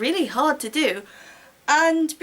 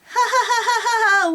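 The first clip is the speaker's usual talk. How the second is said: higher, louder hammed-up taunting cackle; mocking laugh; 9 semitones higher, 4 dB louder than usual. Boyfriend jeering at the heroine, loud mocking cackle. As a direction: cackle at her like a smug bully.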